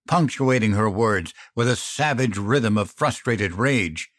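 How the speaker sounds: usual speaking voice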